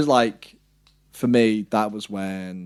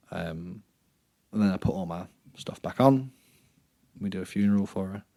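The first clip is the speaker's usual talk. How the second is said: ordinary delivery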